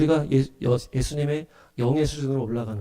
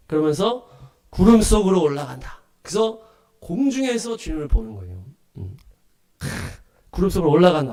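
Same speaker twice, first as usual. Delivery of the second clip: ordinary delivery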